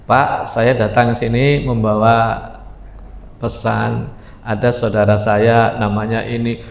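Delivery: Abu Darda proposing. Acted. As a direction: address the elder low and respectfully.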